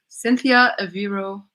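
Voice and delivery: ordinary delivery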